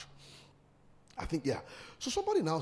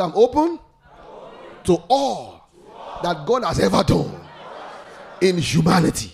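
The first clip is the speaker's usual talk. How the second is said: said loud; 9 dB louder than usual. Real, not acted. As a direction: shout the declaration with the crowd.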